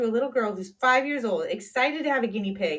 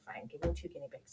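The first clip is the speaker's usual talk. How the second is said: defeated whisper; 8 semitones lower, 14 dB quieter than usual. Retold or imitated. Defeated reply, unvoiced.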